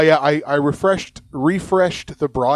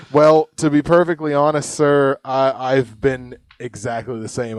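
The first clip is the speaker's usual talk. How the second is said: ordinary talk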